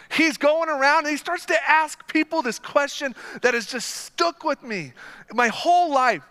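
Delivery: ordinary talk, nothing marked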